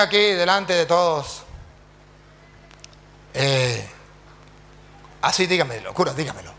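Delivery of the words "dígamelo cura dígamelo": ordinary talk, nothing marked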